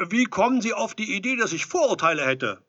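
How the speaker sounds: high, loud, indignant question; loud protest. A narrator's reading. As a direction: protest the accusation through the door. Edelstein protests the accusation.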